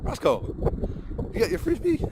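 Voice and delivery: very high rising question; high dog-talk question. Real, not acted. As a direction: ask the puppy sweetly. Talking to dog.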